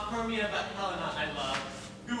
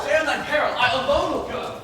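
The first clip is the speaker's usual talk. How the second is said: much higher and louder, a harsh warning; a furious man warning off a woman who follows him; angry threat; 8 semitones higher, 10 dB louder than usual. Acted. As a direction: threaten her so she stays back. Demetrius threatens Helena, leaves.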